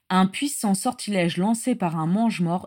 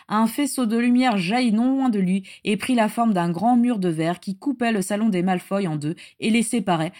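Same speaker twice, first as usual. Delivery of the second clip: ordinary delivery, nothing marked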